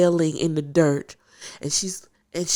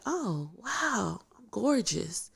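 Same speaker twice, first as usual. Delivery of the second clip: softly wowed, pitch lifting; retold soft awe; 4 semitones higher, 7 dB quieter, slower than usual. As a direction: marvel under your breath. Retold awe at seeing own DNA.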